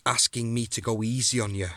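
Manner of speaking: ordinary delivery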